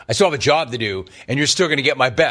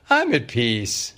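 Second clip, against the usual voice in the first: imitating Gino; 4 semitones lower, slower than usual. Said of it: "I did not expect Gino to say".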